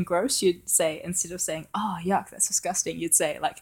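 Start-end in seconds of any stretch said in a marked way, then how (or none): none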